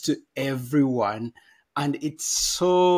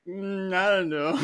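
unsure hesitation; 3 semitones higher than usual. Retold or imitated. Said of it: "put in a place of like".

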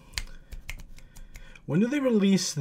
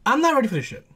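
higher, with NOT thrown upward; worked up; 6 semitones higher, much faster than usual. Real, not acted. Fan bracing for the reveal, worked up. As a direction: insist on NOT, pitched high. Repeats it, too excited to start.

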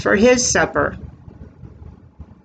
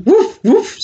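high, louder playful woof; dog bark; 11 semitones higher, 4 dB louder than usual. Acted. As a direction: bark like a cartoon puppy. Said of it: "says the dog".

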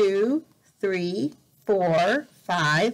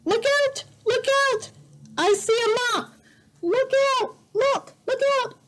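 alarmed warning; faster than usual. Acted. Animals shriek at the kids that a mop is coming. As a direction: shout a high alarmed warning.

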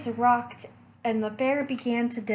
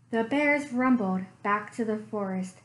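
plain reading voice

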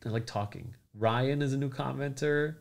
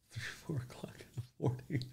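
whisper; 4 semitones higher, 9 dB quieter than usual. Real, not acted. Teasing late viewers.

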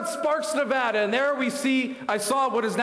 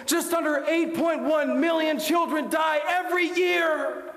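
preaching shout; 7 semitones higher, slower than usual. Acted. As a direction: drive home the number like a hammer. Climax of statistics.

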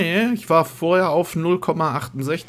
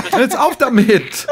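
slow, higher laughing plea; 10 semitones higher, 6 dB louder, slower than usual. Real, not acted. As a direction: giggle through it, drawn out. Laughing at spam messages.

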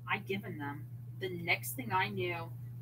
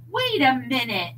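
suspicious; 3 semitones higher, 9 dB louder than usual. A narrator's reading. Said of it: "eyes narrowed".

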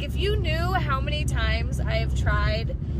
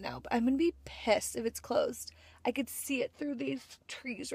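whisper; 7 dB quieter than usual. Real, not acted. Arriving, fearing the place is closed.

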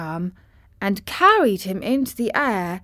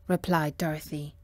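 usual speaking voice

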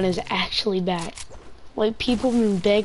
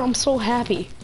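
ordinary delivery

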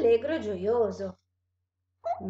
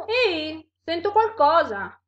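raised, loud monster voice; alert monster; 6 semitones higher, 7 dB louder than usual. Acted. Monster walking with the mouse hears something, alert. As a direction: call out on hearing something nearby.

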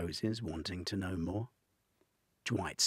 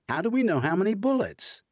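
high, fast, loud exasperation; can't believe the absurdity; exasperated disbelief; 14 semitones higher, 11 dB louder, faster than usual. A narrator's reading. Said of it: "at the lunacy of the clause".

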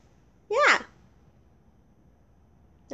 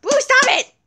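high, sharp order to stop; scolding; 13 semitones higher, 3 dB louder than usual. Pretend. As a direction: tell off a misbehaving daughter in play. Scolding the daughter Boo.

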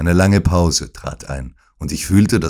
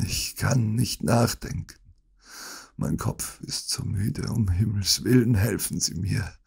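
faint, tired plea, trailing softer; a touch of a feeble invalid begging; weak dying man; 4 semitones higher, 6 dB quieter than usual. Acted. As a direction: sound too exhausted to think. Holmes feigns dying.

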